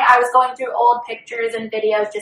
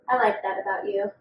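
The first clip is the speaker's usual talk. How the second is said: ordinary talk, nothing marked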